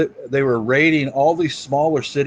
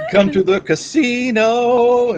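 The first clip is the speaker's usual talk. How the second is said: late night TV ad; 10 semitones higher, 3 dB louder, slower than usual. Retold or imitated. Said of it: "late night T V commercial".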